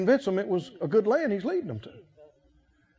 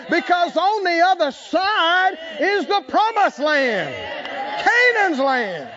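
rising, shouted preaching with slow drawn-out peaks; full-force preaching; 6 semitones higher, slower than usual. Acted.

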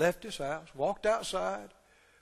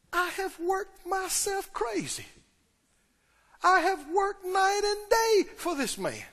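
upset, aggrieved; 8 semitones higher than usual. Acted. Older son brooding on his night-and-day work, aggrieved. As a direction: build a wounded tirade, pitched way up. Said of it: "He's upset".

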